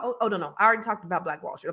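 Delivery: normal talking voice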